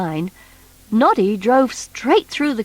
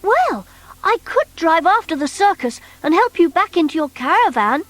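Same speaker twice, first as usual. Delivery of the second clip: put-on high, bright little toy boy; Noddy's voice; 7 semitones higher than usual. Noddy asking the strange creature, high boyish voice. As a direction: pitch up as young Noddy.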